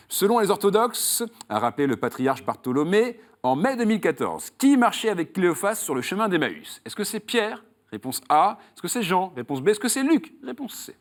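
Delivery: high, punchy game-show host voice with rising options; quiz question read. Acted. Host quizzes a contestant on Emmaus, quiz question read. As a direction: build the question up, then offer each choice.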